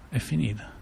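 soft, hushed inner thought; a stunned private murmur; quiet despair. Retold or imitated. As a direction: let it drop out of you, barely voiced. Sudden thought at terrible news.

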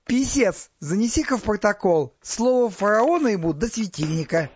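high, loud ruler's order; indignant command. A narrator's reading. Pharaoh orders the scribe to record an insult, indignant and loud. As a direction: call out sarcastically to a scribe.

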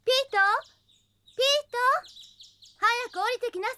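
calling out high to someone upstairs. Real, not acted. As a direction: raise your voice to call him down the stairs. Mother calls boy downstairs.

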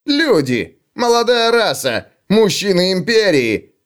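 high, exasperated exclamations; scornful. Acted. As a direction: throw up hands at humans. Dwarf scoffs at humans. (презрительные возгласы гнома)

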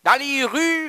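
ordinary talk

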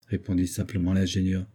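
ordinary delivery

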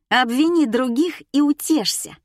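high, loud taunt; loud jeering. A narrator's reading. Alisa mocks conscience-stricken Nika, jeering loudly. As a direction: throw it mockingly in her face.

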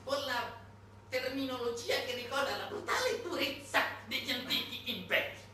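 pompous declaiming quote. Retold or imitated. Mocking the strategist's doctrine to the audience, loud pompous proclamation. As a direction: thunder the imperial doctrine like an orator. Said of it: "Quel Zelensky semplifica così".

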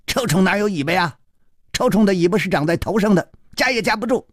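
high, quick mocking retort; fast cheeky taunt in a high voice; jeering. A narrator's reading. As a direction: cap your friend's insult gleefully. Second youngster joins the taunting.

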